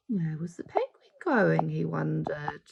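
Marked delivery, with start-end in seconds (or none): none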